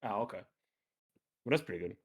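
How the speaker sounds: ordinary delivery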